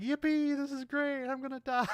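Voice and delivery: high, loud whoop of pure glee; mock gleeful screaming. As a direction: shriek it like a thrill ride. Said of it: "screaming with glee".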